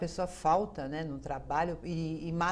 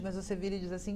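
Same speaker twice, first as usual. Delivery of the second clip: ordinary talk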